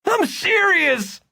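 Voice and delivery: insist, raising your voice; shouting. A narrator's reading. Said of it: "Victor shouted".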